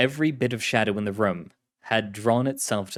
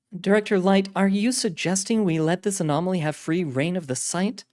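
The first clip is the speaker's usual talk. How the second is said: high, incredulous officer voice; questioning containment officer; 8 semitones higher than usual. Acted. Officer questions director about freeing the anomaly, high, doubtful voice. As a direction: challenge the order as a question.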